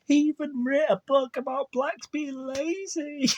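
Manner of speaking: high incredulous cry at the book; incredulous outburst. Real, not acted. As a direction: blurt it out, astonished. Reacting incredulously to clip.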